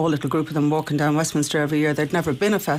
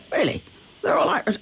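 mock amazed Boris; 8 semitones higher than usual. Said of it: "I said".

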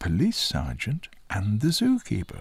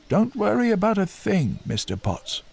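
high, louder keeper voice offering reassurance; helpful zookeeper, pitched well up; reassuring zookeeper; 13 semitones higher, 4 dB louder than usual. A narrator's reading. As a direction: buck him up with a raised, hearty delivery. Zookeeper reassures Mister Potts.

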